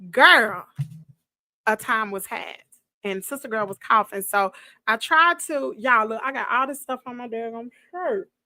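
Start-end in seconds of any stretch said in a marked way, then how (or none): none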